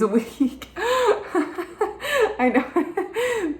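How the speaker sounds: high laughing through the words; giggling confession. Real, not acted. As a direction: laugh at yourself while admitting it. Laughing at her own party habits.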